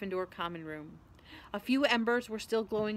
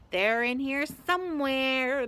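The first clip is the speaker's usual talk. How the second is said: slow, drawn-out, higher creepy searcher; muttering Filch; 4 semitones higher, 5 dB louder, slower than usual. A narrator's reading. Filch searches the trophy room for students, muttering slowly. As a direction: stretch the words out, on the hunt. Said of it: "they heard him mutter".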